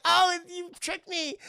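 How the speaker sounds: high, slow mock-playful voice; playful lover. Streamer mimics Anakin rolling with Padme, mock playfulness. Imitates playful couple laughing in grass.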